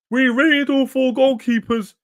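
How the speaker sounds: high-pitched, drawn-out mock claim; mocking quote. Mocking what is being claimed.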